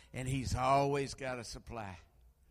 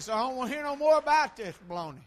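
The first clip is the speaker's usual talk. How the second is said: exasperated shout; 9 semitones higher, 9 dB louder, faster than usual. Retold or imitated. Shouting at son at deathbed.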